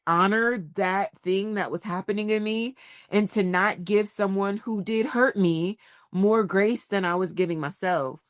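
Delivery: ordinary talk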